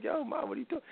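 high, soft yelp of surprise; pleading with mom mid-beating; high startled protest. Retold or imitated. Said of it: "I'm like".

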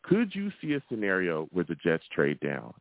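ordinary talk

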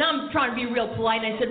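usual speaking voice

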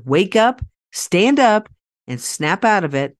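rally the crowd from the stage; rousing speaker's call. Shown as a demonstration. Speaker rousing an audience of pet parents, a loud call. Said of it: "as I would say in my speaking career".

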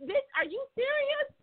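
high shrieking outrage; high incredulous squeal; outraged disbelief. Retold or imitated. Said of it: "I'm like".

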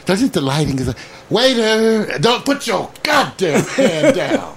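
mock girlfriend. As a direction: ape her fussing with a laugh. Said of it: "she would go like".

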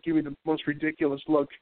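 usual speaking voice

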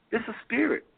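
ordinary talk

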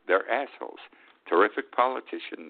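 usual speaking voice

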